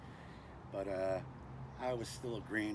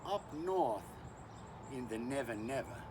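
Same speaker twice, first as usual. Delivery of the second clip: fake Australian accent; 4 dB quieter than usual. Bushman introducing himself to YouTube, fake Australian accent. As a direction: put on a bad Australian accent. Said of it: "with my fake Australian accent".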